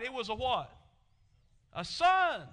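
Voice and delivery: loud, ringing pulpit delivery; preaching at full force. Acted. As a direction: drive each line home to the congregation. Preacher reveals answer loudly.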